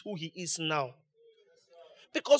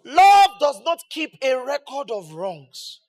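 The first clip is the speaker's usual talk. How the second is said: shouted slowly, much louder; 5 semitones higher, 10 dB louder, slower than usual. Real, not acted. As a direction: proclaim it with the whole room. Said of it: "read ... as loud as you can".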